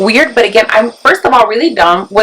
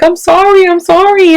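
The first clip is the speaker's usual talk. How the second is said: high, pleading sorry; imitating pained apology; 7 semitones higher, 3 dB louder than usual. Daughter apologising to mom on the phone, pained high plea. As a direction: apologise like a hurt, scared kid.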